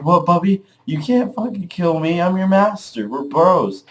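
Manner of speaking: high-pitched, incredulous protest; retold offended appeal; indignant pleading. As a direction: argue back in mock disbelief, pitch up. Pleads with dog threatening death.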